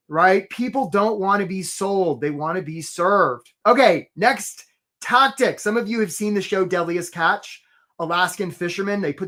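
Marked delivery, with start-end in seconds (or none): none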